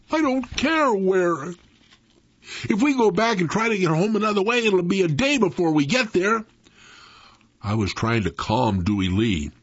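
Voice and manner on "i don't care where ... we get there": put-on high-pitched, worked-up boy's protest; anxious protest. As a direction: argue back in mild panic. Boys' frightened argument in story.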